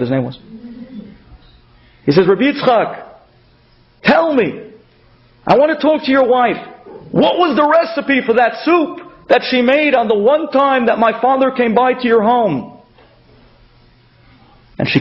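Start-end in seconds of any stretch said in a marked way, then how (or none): 2.3-12.7 eager request. Retold or imitated. Son begs the water carrier for the soup recipe, eager and high. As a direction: voice lifted high, eager and urgent.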